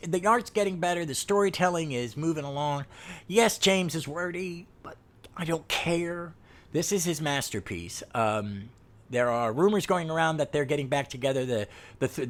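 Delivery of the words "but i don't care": breathy whispered aside; a conspiratorial whispered shrug. Real, not acted. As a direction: drop to a whisper, dismissive. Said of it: "shrugging off wordiness".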